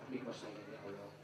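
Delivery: barely audible, low plea to God; hushed, low retold prayer; murmured prayer. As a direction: pray under your breath, acted out. Retold private prayer continues.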